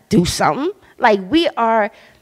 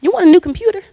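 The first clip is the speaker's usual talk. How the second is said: high, bright-eyed question, a little louder; surprised offer; 10 semitones higher, 4 dB louder, much faster than usual. Retold or imitated. Coworkers offering a new computer, surprised and higher. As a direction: act out their sudden offer. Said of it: "they turned to me and said".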